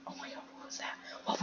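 startled whisper. Real, not acted. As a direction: hush it, spooked. Startled by a noise.

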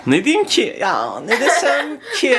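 high, slow laughing echo; mocking echo. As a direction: parrot his words back, laughing. Mock echo of friend, laughing.

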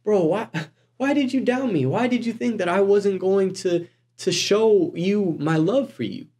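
acted hurt buddy asking why; plead, mildly exasperated, with a friend who doubted; let-down friend. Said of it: "And you're just like".

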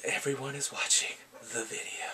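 voiceless conspiratorial whisper; hushed reveal. Real, not acted. Recapping a show's taboo video scene, whispered. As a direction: whisper it like a juicy secret.

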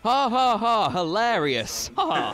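sarcastic fake laugh, then deadpan; dry mock laugh, unimpressed; annoyed laugh. Sarcastic reaction to house fire story.

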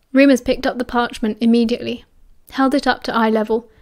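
ordinary delivery

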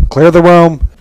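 high, loud alarmed cry; shouted warning to clear out. Acted. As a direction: bellow it to everyone. Reacting to a bad smell.

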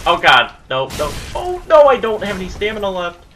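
loud, high panicked pleading; terrified. Real, not acted. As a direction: panic with no stamina left. Out of stamina against the boss.

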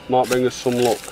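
ordinary delivery

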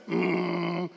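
low, soft sleeper's drone; imitated sleeping hum. Cuban still asleep at six, imitated sleeping drone.

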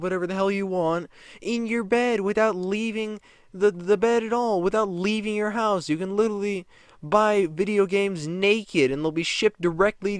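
shouting mock rant; angry rant. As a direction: tear into her in a rage. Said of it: "what I was thinking".

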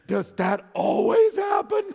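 high, loud astonished cry; amazed. Retold or imitated. Friends marvel outside grandpa's door, high amazed shout. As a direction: burst out in disbelief.

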